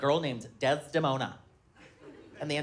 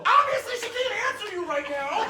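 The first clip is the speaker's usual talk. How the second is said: flustered mock shout. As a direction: blurt it out caught red-handed. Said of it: "I go".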